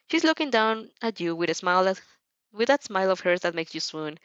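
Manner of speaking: usual speaking voice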